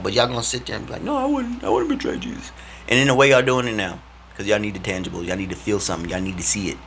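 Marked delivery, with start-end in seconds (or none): 1.0-2.5 high, prissy mocking voice; mock denial. Mocking people who say they'd never betray Jesus, high and squeaky. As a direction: parody their fake loyalty.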